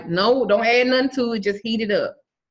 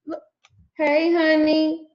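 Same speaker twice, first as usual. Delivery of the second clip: higher, slow pet talk; cooing to cat; 7 semitones higher, slower than usual. Real, not acted. Talking sweetly to her cat.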